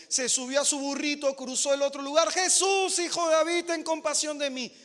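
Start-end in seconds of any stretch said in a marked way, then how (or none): 2.3-4.7 loud, high cry after Jesus; mother shouting after Jesus; shouting plea. Acted. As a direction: shout his name, begging. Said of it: "Seguía gritando la mujer".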